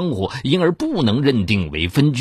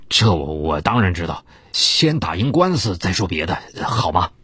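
placating; 7 semitones lower than usual. A narrator's reading. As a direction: soothe her, forcing a smile. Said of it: "李波陪笑".

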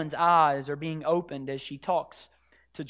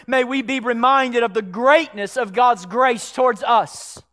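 loud, raised, impassioned exhortation; full-force preaching; 6 semitones higher, 9 dB louder than usual. Real, not acted. Preacher reminding the church of God's grace, full-force preaching. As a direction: press on with forceful conviction.

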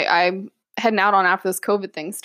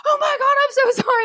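very high, rushed mock horror; imitating a mortified medic; panicked apology; faster than usual. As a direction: squeal out a flustered apology. Retold horrified apology after mishap.

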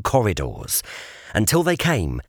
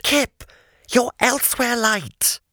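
a lightly voiced boy, pitched higher; a hint of an awed boy, a touch higher; Jamie's voice; 13 semitones higher than usual.

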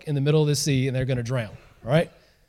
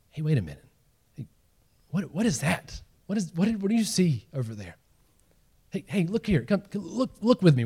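hushed disciple; 6 dB quieter than usual. Acted. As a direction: nudge a friend quietly at night. Disciple secretly spotting figure at night.

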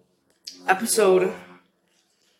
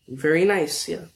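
ordinary delivery

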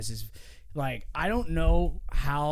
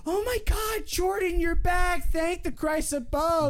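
overjoyed Carson; 12 semitones higher than usual. Retold or imitated. Carson greets J-Matt with a high, thrilled cry. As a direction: shriek with joy at an old friend returning. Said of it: "He's like".

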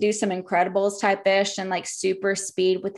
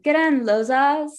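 mock British accent, higher and louder; bad British accent; 5 semitones higher, 3 dB louder than usual. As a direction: call it out in a hammed-up British accent. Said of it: "they have a British accent".

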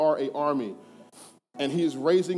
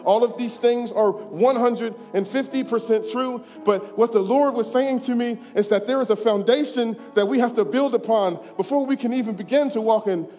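higher, louder pulpit voice, building with conviction; full-force preaching; 9 semitones higher, 7 dB louder than usual. Acted. Pastor preaching kingdom culture to his church at full force. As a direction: drive each truth home to the congregation.

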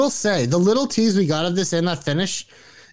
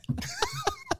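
laugh; 12 dB quieter than usual. Real, not acted. Cracking up after twister.

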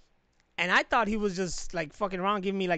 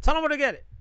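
louder urging repeat; 9 semitones higher, 8 dB louder, much faster than usual. Real, not acted. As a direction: push harder, higher and louder, on where. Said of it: "Tell 'em where to get it".

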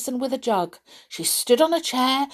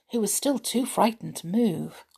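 ordinary delivery

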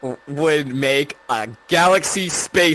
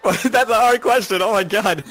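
laughing; 8 semitones higher, 4 dB louder than usual. Real, not acted. Hard question, laughs.

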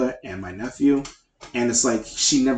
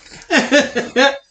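loud laugh; 6 dB louder than usual. Real, not acted. Friends joking on podcast.

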